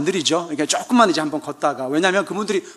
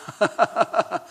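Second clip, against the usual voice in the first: short amused laugh; 2 semitones higher, 4 dB quieter than usual. Real, not acted. Laughing at own impression.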